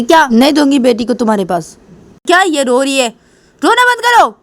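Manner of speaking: high, angry snapped order; acted yell at a crying child; shouting at baby. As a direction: refuse angrily, rising to a shout. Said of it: "तुम बेटी के ऊपर क्यों चिल्ला रही हो".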